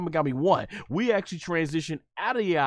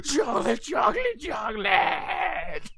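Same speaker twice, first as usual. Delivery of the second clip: temper tantrum wail; 6 semitones higher, slower than usual. Retold or imitated. Majin Buu throwing a tantrum for chocolate, wailing shout. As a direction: bawl it like a spoiled child throwing a fit. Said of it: "having a temper tantrum".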